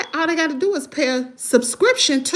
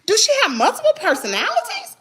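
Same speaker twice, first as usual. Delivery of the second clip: retelling her reaction to the live video, incredulous and much higher; 13 semitones higher than usual. Retold or imitated. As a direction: play your own stunned reaction big. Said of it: "I was like".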